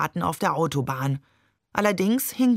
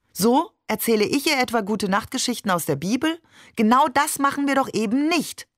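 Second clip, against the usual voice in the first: irritated retort; 7 semitones higher, faster than usual. Acted. Mother argues back at Amir.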